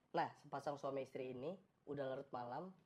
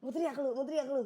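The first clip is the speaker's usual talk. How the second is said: high, louder menacing challenge; threatening robber; 9 semitones higher, 6 dB louder than usual. Acted. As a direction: threaten a hostage in a raised voice. Maling mengancam istri. (mengancam dengan suara tinggi)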